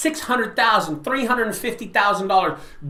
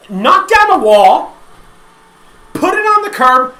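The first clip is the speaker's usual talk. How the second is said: loud, higher and forceful; heated rant; 6 semitones higher, 5 dB louder than usual. Real, not acted. Telling viewers why remodelers took the jobs, loud and higher. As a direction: drive each reason home. Ranting about remodelers and consumers.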